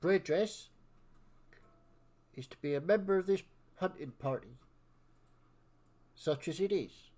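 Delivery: self-important senior of the hunting party; bossy elder. Acted. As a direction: pronounce the ruling in halting, weighty chunks. Elder decrees dragon joins party.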